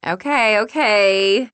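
loud, bursting out of the hug; breaking out. Acted. Julie breaking out of the awkward group hug, loud. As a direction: break the tension with a loud okay. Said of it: "breaking out".

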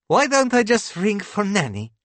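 high, cheery wife's character voice; bright. A narrator's reading. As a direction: chirp the suggestion brightly, pitched well up. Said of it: "Clitzia says brightly".

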